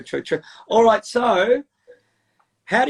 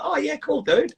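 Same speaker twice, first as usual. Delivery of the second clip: high, breezy "oh yeah, cool, dude"; easygoing mock nonchalance; casual cool dude; 9 semitones higher, slower than usual. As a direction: toss it off like a laid-back Aussie. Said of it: "And I'd be like".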